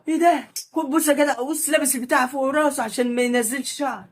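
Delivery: high, amazed running commentary; excited disbelief. Real, not acted. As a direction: gush in amazement at the video. Reacting to food video. (دهشة وحماس بصوت عالي)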